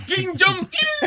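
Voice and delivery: slow, high, drawn-out mock Korean "Kim Jong-il"; mock Korean name. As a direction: stretch it out slowly and high as a joke.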